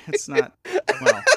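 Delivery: sudden high-pitched surprise; surprised squeak. Real, not acted. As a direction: squeak it out in shock.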